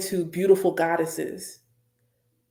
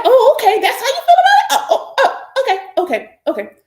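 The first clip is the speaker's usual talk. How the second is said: sarcastic scorned mother; 10 dB louder, faster than usual. Acted.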